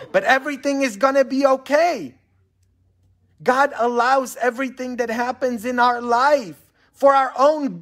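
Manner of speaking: high, loud pulpit proclamation; fervent preaching. Acted. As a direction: ring it out to the whole congregation. Preacher at full force.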